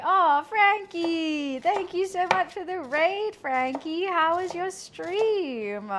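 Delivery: slow, drawn-out warm greeting; thrilled thanks to a raiding streamer; delighted thanks. Real, not acted. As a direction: gush over the raid. Thanking a raider.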